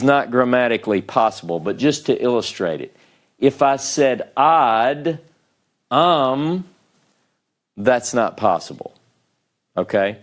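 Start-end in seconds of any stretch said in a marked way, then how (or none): 4.4-6.6 "ad", a pause, then "um" on its own; wrongly split syllables. Shown as a demonstration. Teacher illustrating an impossible division, syllables held apart. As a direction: draw out "ad", stop, then a separate "um". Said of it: "If I did it like this".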